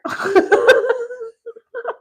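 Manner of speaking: high, loud burst of laughter. Real, not acted. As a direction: let the laugh break out high. Laugh after quote.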